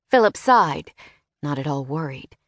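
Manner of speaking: ordinary delivery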